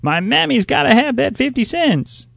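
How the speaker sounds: put-on high, loud child's scream; shrill scream. A narrator's reading. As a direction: scream it at the top of her voice. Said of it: "screamed at the top of her shrill voice".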